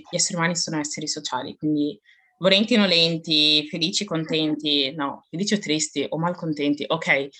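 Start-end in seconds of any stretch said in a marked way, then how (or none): none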